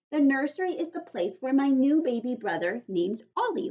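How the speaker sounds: Junie B. narrating. Acted. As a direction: read it as an excited kid.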